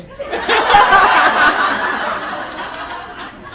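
loud breathy laughter. Real, not acted. Laughing at the two ladies outside, a breathy laugh. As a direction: wheeze with laughter. Laughing at massage parlor memory.

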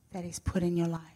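ordinary talk

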